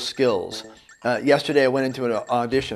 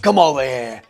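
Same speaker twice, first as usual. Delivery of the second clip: slightly evil Cockney, lightly done; a touch of a sinister Cockney geezer calling someone over; Cockney accent. Shown as a demonstration. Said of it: "And so I did it like this".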